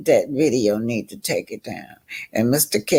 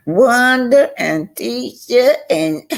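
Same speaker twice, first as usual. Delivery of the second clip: laughing; 10 semitones higher, slower than usual. Real, not acted. Laughing while naming rivals.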